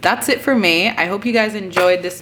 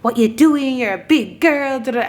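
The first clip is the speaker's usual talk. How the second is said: mock angry mom; 5 semitones higher than usual. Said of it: "my mom was pissed. She's like".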